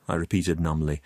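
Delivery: plain reading voice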